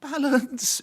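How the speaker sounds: high, drawn-out "Balance"; a high sing-song warning of "Balance"; producer's warning. Retold or imitated. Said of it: "So he says".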